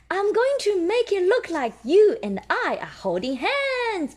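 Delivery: high little piggy voice; high excited child plan; Peppa's voice. Acted. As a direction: say it like an eager little girl. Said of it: "Peppa says".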